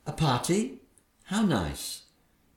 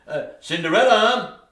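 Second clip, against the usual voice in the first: louder, higher call across the house; 6 semitones higher, 5 dB louder than usual. Acted. Father calling for Cinderella, loud call. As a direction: summon her from afar.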